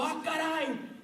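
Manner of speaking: startled gasp at the water; mock surprise. Preacher voicing his own startled cry, surprised. Imagined reaction to flood.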